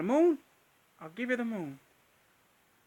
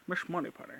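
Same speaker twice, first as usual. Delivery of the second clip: Sean Connery voice; 7 dB quieter, faster than usual. Pretend. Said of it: "Sean Connery".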